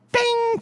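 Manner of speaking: sharp high ding of a bell; high bell ping. Pretend. Opening round of the fight, bell ping. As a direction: ring it out like a boxing bell.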